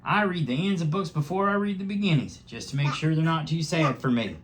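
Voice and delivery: high, eager hedgehog voice; the hedgehog joining in for the bunnies; Dill Prickle the hedgehog. A narrator's reading. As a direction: pipe up cheerfully. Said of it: "said Dill Prickle".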